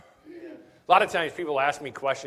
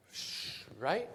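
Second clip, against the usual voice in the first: ordinary talk, nothing marked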